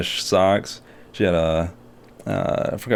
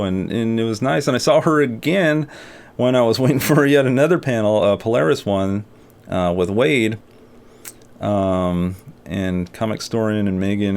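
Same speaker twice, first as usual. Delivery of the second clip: ordinary talk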